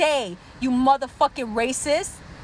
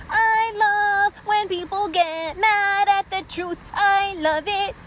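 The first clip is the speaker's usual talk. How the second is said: high singsong taunting, vowels stretched; gleeful taunt; 6 semitones higher than usual. Real, not acted. Taunting the angry viewers in singsong.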